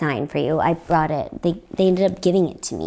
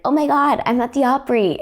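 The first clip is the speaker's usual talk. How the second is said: excited gush; 8 semitones higher than usual. Retold or imitated. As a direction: squeal it like a thrilled newcomer. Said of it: "[name]'s is like".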